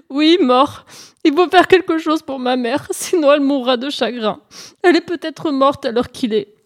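high, hammed-up weeping plea; tearful. Hare begs Pan to help his mother, tearful. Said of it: "se présenta à lui en pleurs".

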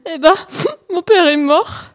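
loud, high mock sobbing; tearful. Hare tells Pan his father is dead, tearful. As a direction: wail it like a pitiful little hare. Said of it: "se présenta à lui en pleurs".

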